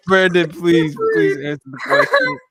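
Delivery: loud plea breaking into laughter; laughing plea. Real, not acted. Host begging Brandon to answer the question, laughing. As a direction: beg through real giggles, rising high.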